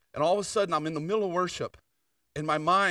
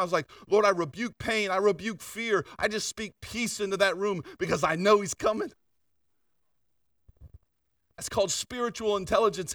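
fervent preaching; 5 semitones higher, 4 dB louder than usual. Acted. Preaching with rising force.

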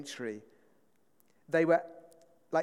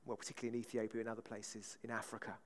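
ordinary delivery

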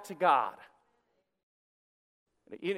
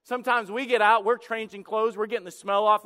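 ordinary talk, nothing marked

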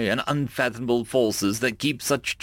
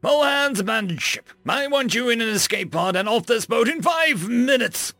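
shouting abandon ship; 11 semitones higher than usual. Acted. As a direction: bark the order over the intercom. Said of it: "shouted over the intercom".

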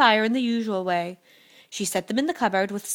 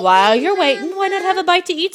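high, loud hostess offer; inviting hostess; 9 semitones higher, 8 dB louder than usual. A narrator's reading. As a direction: press food on the guest. Wife invites the giant to eat.

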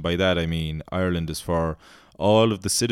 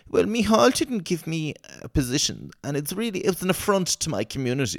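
high, whiny mock politician; mocking junior minister; 10 semitones higher than usual. Mocking a sulking junior minister, whiny. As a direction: sneer it in a high whine.

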